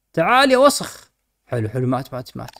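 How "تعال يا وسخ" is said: high mock-insulting call. Real, not acted. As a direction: call it over, high and teasing. Calling pet in game.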